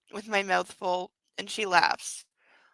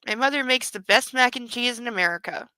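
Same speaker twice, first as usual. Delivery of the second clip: ordinary talk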